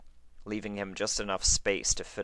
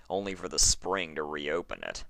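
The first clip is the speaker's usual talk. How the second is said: ordinary delivery, nothing marked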